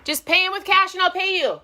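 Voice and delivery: high, loud call across the house; real shout. Real, not acted. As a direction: holler instructions to someone in another room. Calls across house to child.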